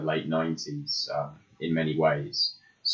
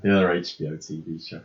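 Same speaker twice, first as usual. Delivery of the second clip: normal talking voice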